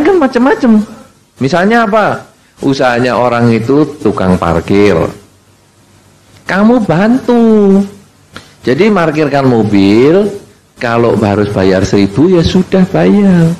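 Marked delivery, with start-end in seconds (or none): none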